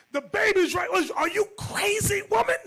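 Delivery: high, loud, outraged yell; husband yelling at his wife over the baby; furious shout. Retold or imitated.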